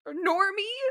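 high sneering rise on Normie; mock-shouting an insult; mock yell. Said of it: "yelling at her".

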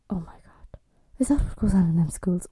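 put-on whispered, scandalised gasp of a pious older woman; hushed and shocked, clutching her pearls.

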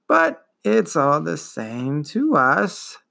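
slow, dragging, wheezing ancient voice; wheezing old turtle. Acted. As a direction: drawl it out like a weary old beast. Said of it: "Morla wheezed".

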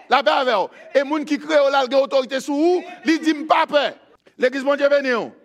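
high, loud sermon delivery; full-force preaching. Acted. Preacher telling the church not to fear, full-force preaching.

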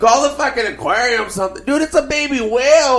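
put-on high-pitched, loud Spanish-accented goofball; mock Spanish accent. Calling Jay over to see a fish, mock Spanish accent. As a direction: play an amazed guy with a Spanish accent, voice way up. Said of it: "you make him sound Spanish".

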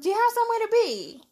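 high exasperated repeat. Real, not acted. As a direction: ask again, fed up now.

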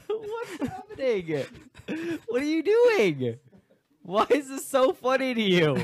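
high, squealing through giggles; can't stop laughing while talking; cracking up mid-line. Real, not acted. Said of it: "You're distracting everybody".

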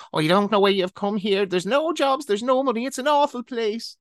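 put-on high, stern old Irish woman's voice; stern elderly Irish woman. Elderly Irish woman warns newcomer off London, stern and high. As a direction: scold him like a dour Irish granny. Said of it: "she looked at me sternly and said".

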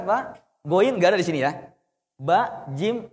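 normal talking voice

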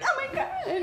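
very high-pitched scandalized gasp; high, giddy mock shock; revealed a big secret. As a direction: play it like a huge secret just got out. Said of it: "she just revealed a big secret".

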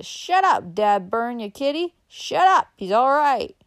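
high, loud yelling urchin; piercing backwoods child's shout; shrill voice. A narrator's reading. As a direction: scold the dog in a shrill holler. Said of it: "in a shrill voice".

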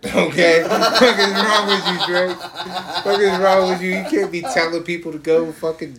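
loud, laughing through the words; cracking up while scolding; laughing rant. Real, not acted. Laughing, scolding [name] for the lyric.

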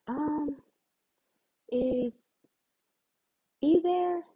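put-on high, loud, hesitant girl's voice; the other girl. Imitating the caller asking for her boyfriend, high and loud. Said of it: "she was like".

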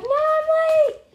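high, slow, drawn-out cry of alarm; panicked wail. Acted. Girl describing a picture voices the heroine waking up late, panicked. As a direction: wail it like you just woke up late. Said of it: "she wakes up and it's like".

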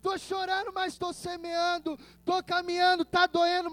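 high, softened pleading; pained pleading prayer. Acted. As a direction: plead like a hurting child to a father. Voices weeping sower praying to God. (súplica dolorida, voz aguda e contida)

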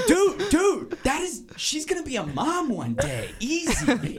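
high, loud hammed-up reproach; shocked scolding. Greaser appalled by his friend's remark, shocked scolding. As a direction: cut your crude buddy off, voice shooting up.

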